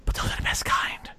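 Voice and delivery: hushed flirty whisper. Retold or imitated. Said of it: "It's like".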